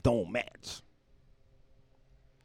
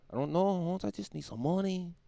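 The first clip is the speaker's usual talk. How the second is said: put-on higher, laid-back California Mexican accent; 9 semitones higher than usual. Day laborer outside Home Depot asking for work, California Mexican accent. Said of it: "I turned him into a, a California Mexican".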